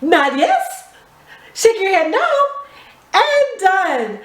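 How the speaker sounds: ordinary talk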